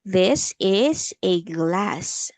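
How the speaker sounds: very slow, word by word for a learner; teacher's slow repeat-after-me delivery; slow model sentence. Shown as a demonstration. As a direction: draw out each word for repeating. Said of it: "a sentence".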